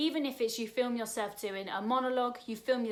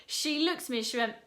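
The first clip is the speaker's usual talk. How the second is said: normal talking voice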